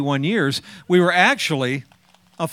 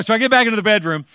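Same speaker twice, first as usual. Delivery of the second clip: ordinary talk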